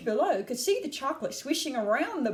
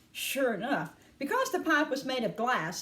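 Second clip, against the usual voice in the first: ordinary delivery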